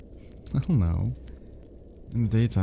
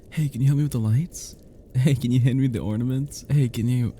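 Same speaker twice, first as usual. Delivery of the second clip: lightly raised, eager mock requests one after another; a playful send-up of needy friends; imitating pestering friends; 6 semitones higher than usual. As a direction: mimic the friends nagging for help. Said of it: "You have no idea how quickly they swarm you".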